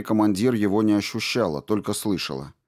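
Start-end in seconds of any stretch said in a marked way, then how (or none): none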